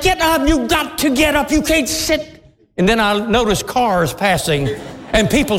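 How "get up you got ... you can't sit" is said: high-pitched, urgent urging; exasperated pleading. Retold or imitated. Adult begging a child to get off the sidewalk, exasperated. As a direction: plead high and insistently, at wits' end.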